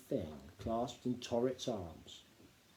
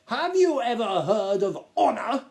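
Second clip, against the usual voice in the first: high, loud, self-important Duke lecturing; a pompous gnome lord talking down to the boy; 9 semitones higher, 9 dB louder than usual. Acted. Duke's pompous speech.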